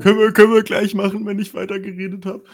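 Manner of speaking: high, fast put-on silly voice; disguised voice. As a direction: speak in a funny disguised voice. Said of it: "Ich hab meine Stimme verstellt".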